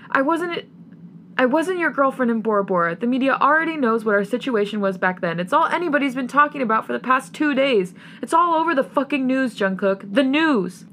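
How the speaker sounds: high, agitated outburst with punched words; heated arguing. A narrator's reading. Acted quarrel, continues after the shriek.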